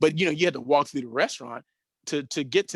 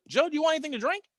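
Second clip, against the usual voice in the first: much higher, coaxing tone to his client; fawning agent; 13 semitones higher, much faster than usual. Retold or imitated. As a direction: agent sweetly offers Joe a beer. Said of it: "looks back at Joe and says".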